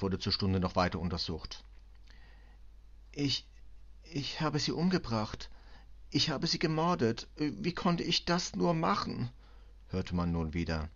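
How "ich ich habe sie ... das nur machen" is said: higher-pitched, despairing foreign woman; distraught confessing cleaning woman. Acted. Cleaning woman confesses to the commissioner, distraught.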